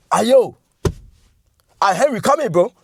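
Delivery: high shouted call across the park; loud, high "Ayo" calling a kid over. Retold or imitated. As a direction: yell his name to get him over. Older lads calling his name.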